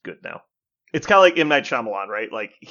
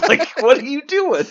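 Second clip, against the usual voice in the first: laughing disbelief; 8 dB louder than usual. Retold or imitated. Mocking absurd magic system.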